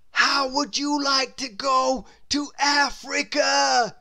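loud, high shout; Mister Daydream shouting mid-flight; shouting over the wind. A narrator's reading. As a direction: call out over rushing air. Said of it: "he shouted".

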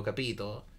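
usual speaking voice

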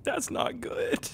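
slow, high, breathy squeak; squeaky high whisper. Retold or imitated. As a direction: squeak it out in a tiny voice. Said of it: "I was like".

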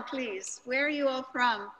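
ordinary talk

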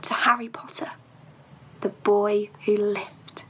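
slow unvoiced whisper; hushed voices toasting in secret. A narrator's reading. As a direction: raise a secret glass, whispering. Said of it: "saying in hushed voices".

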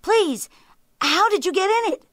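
high, loud, hurried demand; impatient. A narrator's reading. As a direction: press the question fast and exasperated. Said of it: "cried the tiger impatiently".

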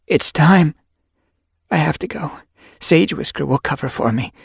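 hurry, the pains have started; lightly voiced she-cat. Yellow Fang in labor.

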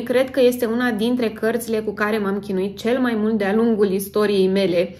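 ordinary delivery

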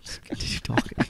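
worn-out whisper under the breath; hushed, weary plea; exasperated whisper. Real, not acted. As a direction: whisper it, fed up with your co-host. Fed up with the co-host.

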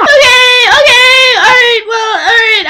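high, loud, wailing agreement; hammed-up panicky whine; freaking out. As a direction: squeal as if failing to calm down. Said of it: "freaking out on the phone".

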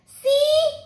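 put-on high, squeaky little kid; toddler voice. Toddler showing his turtle to a guest, high and eager. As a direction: pipe it up like a proud toddler.

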